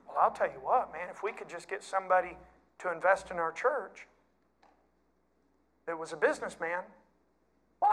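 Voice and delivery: hushed, scheming church planner voice; worldly schemer. Retold or imitated. Preacher mimics a church leader, hushed scheming. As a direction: softly sell a shady money plan.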